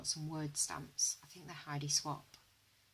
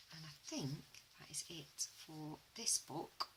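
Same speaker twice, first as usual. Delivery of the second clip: whispering; 9 dB quieter than usual. Real, not acted. Winding down the flip through.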